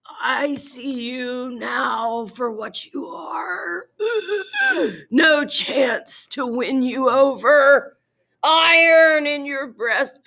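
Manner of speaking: slow, gasping words of a dying man. Acted. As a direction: gasp it out, near death. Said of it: "Then at the point of death, Lord Hector said".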